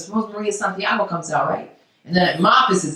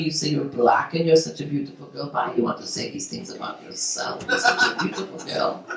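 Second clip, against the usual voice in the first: hushed mother-in-law; 7 semitones lower, 7 dB quieter than usual. Retold or imitated.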